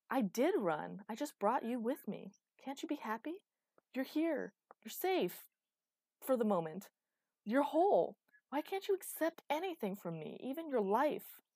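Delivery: high, loud, impassioned argument; heated and swooping upward; exasperated pleading. Acted. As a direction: argue back, pleading to be accepted. Acted quarrel, Phaestus argues back.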